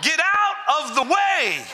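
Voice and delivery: slow, shouted mock frustration; mock road rage. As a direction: yell at the slow car ahead, half-joking. Stuck behind slow driver.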